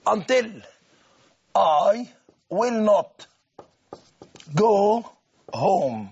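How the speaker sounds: slow dictation with long gaps between words; dictation pace. Shown as a demonstration. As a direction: give the class time to copy. Dictates until example word by word.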